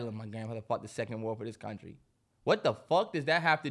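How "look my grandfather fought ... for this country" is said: low, much softer imitation of a claim; mock quote. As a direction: toss off the quote low and quiet. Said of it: "say shit like".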